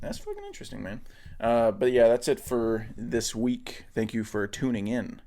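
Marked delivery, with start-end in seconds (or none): 0.0-1.0 mock imitation. Mocking partner's line.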